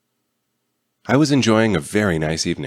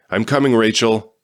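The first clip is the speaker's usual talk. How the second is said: calling out; 4 semitones higher, 5 dB louder than usual. A narrator's reading. Rescuer calling out.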